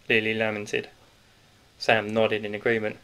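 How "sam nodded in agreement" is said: ordinary delivery, nothing marked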